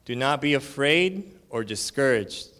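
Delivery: slow, weighty scripture read. Acted. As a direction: proclaim each phrase slowly. Reading scripture aloud.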